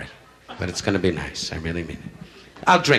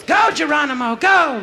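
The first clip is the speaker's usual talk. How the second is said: much higher, louder cheering yell; wife's battle cry; 14 semitones higher, 4 dB louder, slower than usual. Retold or imitated. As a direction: urge him on like a war whoop.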